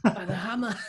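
laughing mild Boston, Hammah with no r; Boston accent. Retold or imitated. Host echoing the Boston bit, laughing Boston accent. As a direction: echo in a light Boston twang. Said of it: "the accent is that".

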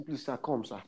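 soft, timid please-sir; quietly pleading student; meek plea. Retold or imitated. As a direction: beg the invigilator under your breath. Student begging invigilator, retold.

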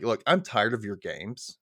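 slow quote broken by laughter; a mock-stern quote that collapses into laughs; laughing while quoting. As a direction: laugh through his stern line. Retelling Lewis confronting Angela.

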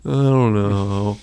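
slow, drawn-out sheepish mumble. Acted. The people answering an angry Moses, sheepish. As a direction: play dumb, dragging the words out.

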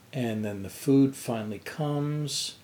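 normal talking voice

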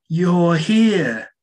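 high, loud twangy 1830s voice; old-fashioned twangy proper accent, projected high. Retold or imitated. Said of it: "kind of like a twangy, proper accent".

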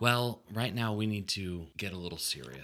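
low, soft late-night radio voice; soothing nineties radio DJ. Pretend. Host turning serious with co-host, soothing DJ voice. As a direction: croon it softly like a DJ. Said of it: "You sound like a very, like, soothing, like, nineties radio D J".

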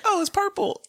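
high, airy imitation of the fiancée's stunned voice; mock dazed surprise. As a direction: squeak it out like she can't believe the ring. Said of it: "And he was like".

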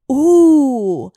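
loud, high, drawn-out ooh; excited ooh. Real, not acted. Host reacting to a listener's episode idea, excited ooh.

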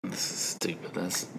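whispered aside under the breath; grumbling whispered aside while the co-host teases. Real, not acted. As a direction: drop to a whisper as if to yourself. Muttered aside during teasing.

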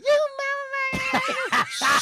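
high mock shriek of a woman in a bar fight; retold scream. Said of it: "women screaming".

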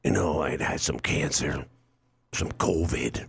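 put-on low, slow, mumbled old-star voice; hammed-up frail movie star; mock dying old actor. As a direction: sound like an aging actor who thinks he's dying. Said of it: "they start talking like this".

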